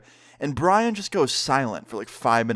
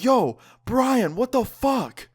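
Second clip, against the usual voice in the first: much higher, incredulous exclamation; retold shocked outcry; 11 semitones higher, 3 dB louder, much slower than usual. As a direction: cry out in high-pitched disbelief. Retold shock at friend through drywall.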